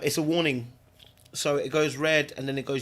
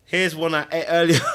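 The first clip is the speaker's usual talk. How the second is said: louder, laughing "Here's one I ate earlier"; laughing joke; 3 semitones higher, 8 dB louder than usual. Real, not acted. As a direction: crack up on it. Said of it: "laughing presenter line".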